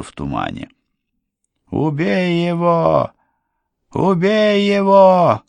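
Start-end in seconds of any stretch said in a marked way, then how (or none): 1.7-3.1 высокий медленный крик ведьмы; кричи вслед, медленно и яростно. A narrator's reading. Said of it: "кричала вслед Полуину ведьма". 3.9-5.4 громче, высокий яростный вопль; надрывайся вслед беглецу; крик ведьмы. A narrator's reading. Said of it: "кричала вслед Полуину ведьма".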